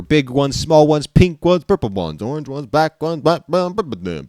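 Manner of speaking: high, bouncy reading that climbs; sing-song list. Acted. Player listing seashells by colour, climbing sing-song. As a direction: pitch the list up until it tumbles into nonsense. List sliding into a silly chant.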